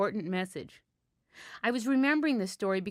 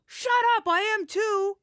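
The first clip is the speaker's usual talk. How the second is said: put-on high, loud indignant girl; indignant protest; 11 semitones higher, 5 dB louder than usual. A narrator's reading. Girl insisting she belongs, shouting high and loud. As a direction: snap back high and loud at the teasing girls.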